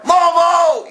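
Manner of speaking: high, loud mock shout out a car window; shouted Momo out the window; yelling Momo. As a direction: holler it at passing cars. You fucking yell.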